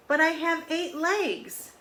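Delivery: an octopus insisting it has too many legs; high indignant octopus. A narrator's reading. Reading aloud, the octopus complains high and loud.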